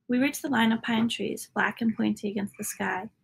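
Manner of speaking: usual speaking voice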